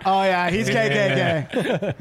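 slow, drawn-out oh yeah; retelling the guard's verdict as a slow punchline. Retold or imitated. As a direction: deliver it dead slow for the laugh.

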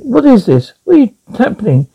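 usual speaking voice

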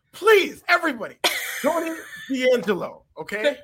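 mock campaign plea. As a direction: beg the audience like a campaign ad. Jokey plea to vote for Tony.

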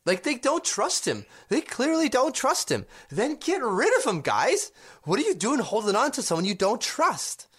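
high-pitched, incredulous plea; exasperated. Real, not acted. Co-host urging the team to fire the manager, exasperated. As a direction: argue it in a high, insistent voice.

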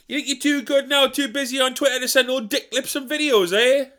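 high, whiny self-pitying moan; martyred grumbler. Acted. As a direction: sulk like a put-upon martyr. Old Dick Lips persona.

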